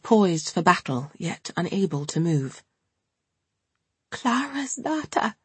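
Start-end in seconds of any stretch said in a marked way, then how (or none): 4.1-5.4 put-on slow, quiet, tearful old woman; tearful whisper. A narrator's reading. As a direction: say it softly, close to tears. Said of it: "in a tearful whisper".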